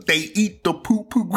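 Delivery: high-pitched meme voice quote; quoting a meme. Retold or imitated. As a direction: squeak it out like the viral clip. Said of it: "so I start quoting the".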